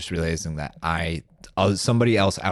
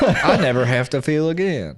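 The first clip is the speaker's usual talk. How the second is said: higher, laughing put-on voice; hammed-up blissed-out burnout; mock stoner; 9 semitones higher, 3 dB louder than usual. Friend riffing on never feeling again, in a laughing put-on voice.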